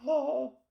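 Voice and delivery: high lovestruck gasp, "oh"; smitten gasp. Retold or imitated. Pirates all falling for her at first sight, smitten gasp. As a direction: gasp in mock adoration.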